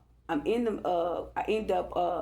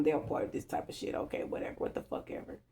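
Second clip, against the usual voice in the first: whispered, fading to nothing; muttered under breath; 8 dB quieter, faster than usual. Retold or imitated. Dismissing the officials to herself, whispered and fading. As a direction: grumble it to yourself in a whisper.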